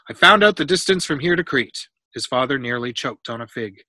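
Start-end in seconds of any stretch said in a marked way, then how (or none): 0.1-1.9 loud, higher proud announcement; announcing. A narrator's reading. Boy telling his parents at dinner, announcing. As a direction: announce the discovery to the room. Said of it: "Pythagoras announced".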